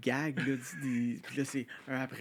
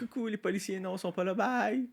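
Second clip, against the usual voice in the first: high teasing taunt, ending in a loud bye; mocking singsong; 8 semitones higher, 5 dB louder than usual. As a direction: taunt the police like a cheeky kid. Mocking the police as Sam.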